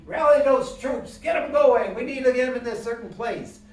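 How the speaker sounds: loud, high rallying cry; banner holder rallying troops. Acted. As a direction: call out urgently to rouse the troops. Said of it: "tell the general where to go".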